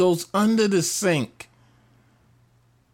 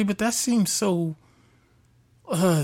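ordinary talk

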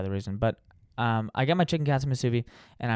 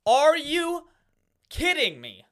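a shout of disbelief, far higher; disbelieving yell; much slower than usual. Retold or imitated. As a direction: blow up at the screen. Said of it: "I'm start screaming".

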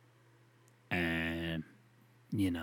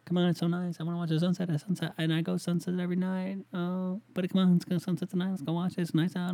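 high mock-girly nagging; girlfriend voice; 9 semitones higher than usual. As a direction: whine it in a high put-on voice. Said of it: "girlfriend voiced in a story".

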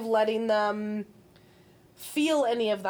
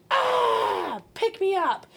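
put-on high, loud baby cry for pickup; whiny baby begging to be picked up; mock fussy baby shouting; baby demanding; 9 semitones higher, 4 dB louder, much slower than usual. Baby voiced wanting pickup.

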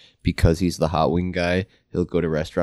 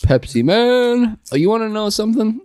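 silly high voice; 4 dB louder than usual. Acted. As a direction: ham up a goofy announcer. Silly character voice exclamation.

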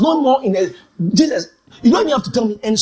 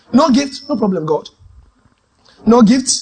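determined declaration; 3 semitones higher, 4 dB louder than usual. Retold or imitated. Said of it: "decided to become Eliezer. Determined".

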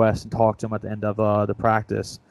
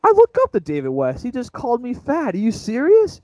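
pitched way up in disbelief; high, incredulous childish outrage; mock shocked kid; 11 semitones higher than usual. Six-year-old hearing his hero call him fat, shocked high voice. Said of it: "I was like".

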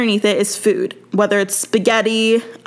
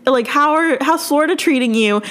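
higher-pitched mock call across a distance; retold call-out; 6 semitones higher than usual. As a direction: imitate calling out after someone running away. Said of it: "Katie yells out and she goes".

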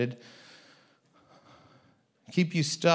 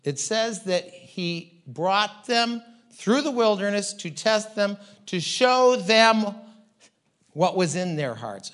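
ordinary talk

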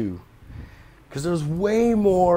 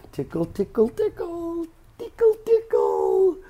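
mocking sing-song, rising much higher; teasing tickle; 13 semitones higher than usual. Host teasing an offended listener, mocking sing-song. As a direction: tease them in a climbing baby voice.

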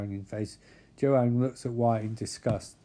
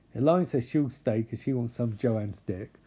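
ordinary talk

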